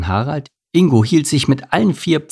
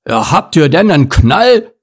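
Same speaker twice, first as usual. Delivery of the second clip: loud, high burst of outrage; exasperated bear blurting loudly; indignant outburst; 7 semitones higher, 7 dB louder than usual. A narrator's reading. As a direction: burst out, cutting them off. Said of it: "platzte Bernd heraus".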